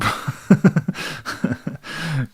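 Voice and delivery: real giggling fit, high; long, high amused laugh. Real, not acted. Amused by a viewer's comment.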